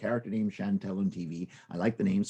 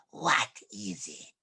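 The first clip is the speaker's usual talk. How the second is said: slow, breathy villain cat; lightly voiced sly cat; 3 semitones higher, slower than usual. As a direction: drawl it out lazily. Cat answers Lucy.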